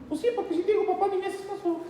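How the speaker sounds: son's voice. Retold or imitated. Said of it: "es mi hijo Baruch".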